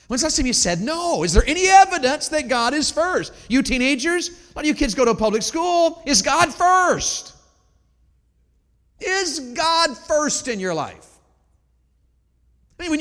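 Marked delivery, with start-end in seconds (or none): 0.1-11.1 much higher and louder, pressing; fervent challenge. Acted. Preacher to teenagers, higher and louder, pressing. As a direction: push the teenagers with a raised voice.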